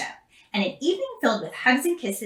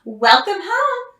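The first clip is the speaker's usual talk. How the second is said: welcoming; 8 semitones higher, 5 dB louder, slower than usual. A narrator's reading. Mother greets her daughter.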